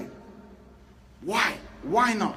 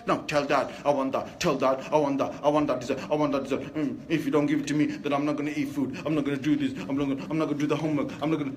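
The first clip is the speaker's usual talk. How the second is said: put-on lower, sulky demands of a spoiled child; a child holding his mother to ransom for dessert, lower and sulky; demanding child attitude; 6 semitones lower, faster than usual. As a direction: whine out the demands like a spoilt kid. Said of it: "all the kind of attitude".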